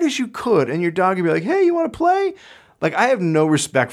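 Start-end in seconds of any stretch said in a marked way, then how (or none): 1.4-2.3 much higher, goofy eager dog; happy dog voice. Retold or imitated. Dog answering its owner after a punch, in a high goofy voice. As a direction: play the dog as clueless and friendly.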